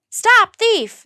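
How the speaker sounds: high loud yell. A narrator's reading. Butcher yelling as Jack runs past. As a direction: shout from the shop door. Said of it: "the cigar seller yelled".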